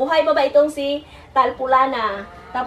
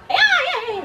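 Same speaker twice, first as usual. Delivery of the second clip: startled; 13 semitones higher than usual. Real, not acted. Startled interruption while talking.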